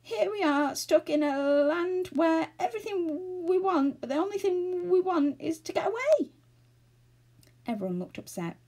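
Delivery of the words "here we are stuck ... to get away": high, quavering wail; loud, high, frightened character voice; trembling voice. A narrator's reading. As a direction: lament in a panicky, wobbly voice. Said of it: "he said in a trembling voice".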